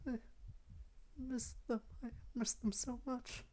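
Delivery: put-on high, soft, timid girl's voice; small, high, halting and hushed; frightened Yuri. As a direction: plead softly in a high, fragile voice. Voices Yuri in visual novel.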